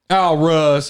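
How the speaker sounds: high, loud whiny sidekick voice. Acted. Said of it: "he's like".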